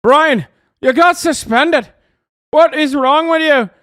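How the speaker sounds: high, loud hammed-up angry dad yelling at Brian; shouting at Brian over reading the Bible; mock dad shout. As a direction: bellow like a furious Irish dad scolding his son. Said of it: "What's up with the dad's accent?".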